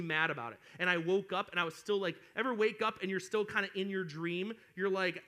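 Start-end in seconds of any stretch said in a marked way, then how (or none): none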